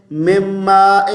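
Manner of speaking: میم ماء said "mem-maa-en", e for i; wrong vowels. Shown as a demonstration. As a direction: say میم ماء unchanted, with open e sounds. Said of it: "تو ہم کیا پڑھتے ہیں".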